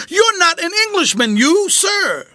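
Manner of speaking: hammed-up terrified old general; panicked accusation. A narrator's reading. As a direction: accuse him in near-shrieking fright. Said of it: "same quivering voice as before".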